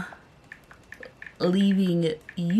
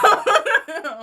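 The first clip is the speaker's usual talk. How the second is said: high-pitched giggle; real high laugh. Real, not acted. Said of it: "I shouldn't be laughing".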